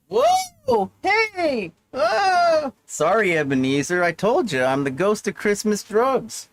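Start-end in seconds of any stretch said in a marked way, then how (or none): 0.1-2.7 very high ghostly wails; tormented echoing moans. Acted. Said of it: "moaning and groaning like a ghost".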